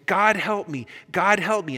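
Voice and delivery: high, anguished acted plea; crying out in agony. Ghost surrendering to the angel, agonized cry. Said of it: "the ghost cries out in agony".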